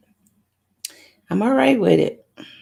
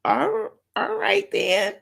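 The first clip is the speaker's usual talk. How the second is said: high reluctant yes; 9 semitones higher than usual. Retold or imitated. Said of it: "I said".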